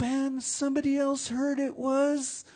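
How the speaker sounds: very high, loud storyteller chant; suspenseful, ringing narration; oral storytelling. A narrator's reading. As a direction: hold it high to build to a reveal. Storyteller builds to reveal.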